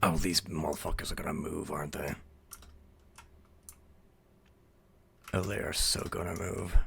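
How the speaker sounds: low, hushed muttering to self; quiet, low-pitched suspicion; wary muttering. Real, not acted. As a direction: mutter it low under your breath. Wary muttering to self while playing.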